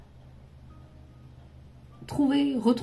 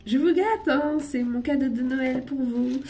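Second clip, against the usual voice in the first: giggling; 4 semitones higher, 4 dB louder, slower than usual. Real, not acted. Teasing viewers while laughing.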